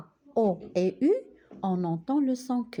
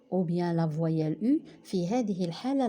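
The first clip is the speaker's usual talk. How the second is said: usual speaking voice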